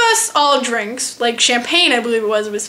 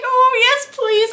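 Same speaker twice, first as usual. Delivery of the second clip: much higher, tight and sheepish; embarrassed squeak; 10 semitones higher, slower than usual. Retold or imitated. Retelling the waitress mix-up, a high embarrassed squeak. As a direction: squeak it out like you're mortified. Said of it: "I was so embarrassed".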